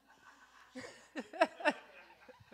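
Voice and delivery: high, breathy burst of laughter; real laugh. Real, not acted. Laughs at counting the months.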